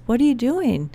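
excited question. Retold or imitated. Dad cheering on a new idea, excited. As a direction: imitate a thrilled dad asking. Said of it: "Like, this is exciting".

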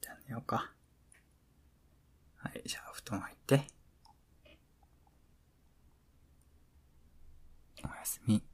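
hushed bedtime whisper; pillow talk. Acted. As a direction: whisper goodnight softly.

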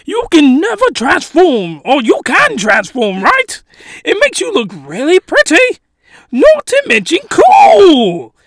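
high, gushing fake British fanboy; hammed-up British excitement, swooping high; terrible British accent. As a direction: get carried away in bad British. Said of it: "terrible British accent".

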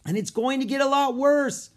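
high, loud shout over the storm; shouting back. A narrator's reading. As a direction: warn loudly against the wind.

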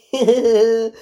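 loud, mocking fake giggle; weird laugh. Mocking an actress's awful flirting, weird laugh. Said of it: "doing this really weird laugh".

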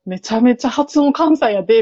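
ordinary talk

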